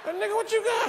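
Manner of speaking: high mock question. Comic asks chewing cousin in the car, high. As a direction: squeak it out in surprise.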